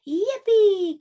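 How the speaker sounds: high, loud squeal of joy; a frog kid thrilled about his new bike; excited cry. A narrator's reading. Froggy cries out to dad in joy, high and loud. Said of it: "cried Froggy".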